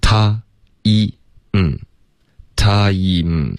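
"他", "一", "嗯" isolated, then blended; step-by-step sounds. Shown as a demonstration. Teacher showing the order of sounds, separated then joined. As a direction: set out "他", "一" and a closed-lip "嗯" one by one. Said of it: "这个前后的顺序是非常重要的".

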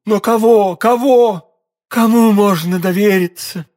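high, increasingly loud desperation; agitated cry. A narrator's reading. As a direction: plead urgently, with nobody to trust. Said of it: "вскричала королева".

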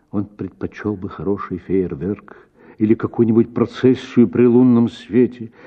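ordinary delivery

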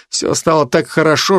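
usual speaking voice

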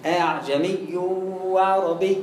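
low recited "أعجمي وعربي" with no madd on the first vowel; eased hamza, unlengthened. Shown as a demonstration. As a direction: recite "أعجمي وعربي" softening the second hamza, without stretching it. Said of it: "مفيش مد".

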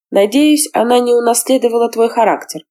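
plain reading voice

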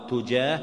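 "تجاه" with u after the ta; damma on the ta. Shown as a demonstration. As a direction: say "تجاه" as tujah. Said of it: "تجيء بالضم والفتح".

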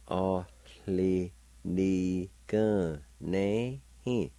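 A li Ni gun ne hin drawn out very slowly; slow teaching pace on A li Ni gun ne hin. Shown as a demonstration. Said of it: "faint H sound like this".

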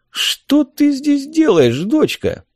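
high, drawn-out amazed question; astonished exclamation. A narrator's reading. Helmsman finding the girl here, astonished. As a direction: stretch it out in disbelief. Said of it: "воскликнул он".

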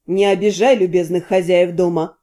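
deep, calm friend voice; low male voice. A narrator's reading. Friend urges her to drink.

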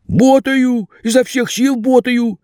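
high, aggrieved pleading; pleading young man. A narrator's reading. Vasya bluffs.

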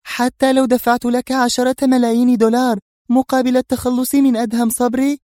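very high, tense female voice; edgy, agitated. A narrator's reading. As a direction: press the offer irritably in a high voice. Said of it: "قالت في عصبية".

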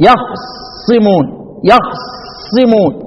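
يخصمون very slow, silent-vowel خ grinding into doubled ص; two sukuns joined. Shown as a demonstration. Teacher showing how hard it is, effortful double-sukun recitation. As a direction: hold yakh with no vowel, then a long ص in يخصمون. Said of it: "شدة وصعوبة على الاختلاس".

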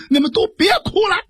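sharp high shout to stop the crying; 大喊一声. Acted. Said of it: "大喊了一声". (蒋平高声急喊众人别哭)

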